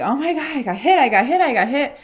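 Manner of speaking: mild panicked retold yell. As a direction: yell it in shock, like you just got hit. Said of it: "I just was yelling".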